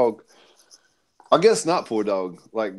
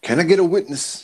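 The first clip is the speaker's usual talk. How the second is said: mock preacher; 6 semitones higher than usual. Playful preacher call after witnesses mention.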